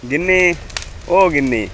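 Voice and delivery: husband calling his wife from inside the house. Acted. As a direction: call her from the next room. Husband calls wife from another room.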